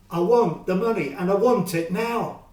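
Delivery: loud and hard, leaning on want; a bully squeezing every penny out; angry demand. Acted. Fred demanding his money from Joe, loud and angry.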